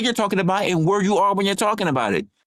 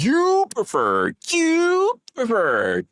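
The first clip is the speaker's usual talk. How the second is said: slow, sing-song mocking taunt; drawn out taunting; 4 semitones higher, much slower than usual. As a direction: stretch it out like a taunt. Taunting repeat.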